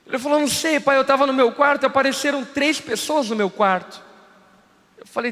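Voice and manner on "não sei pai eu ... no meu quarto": put-on child voice. Child voiced in retold story.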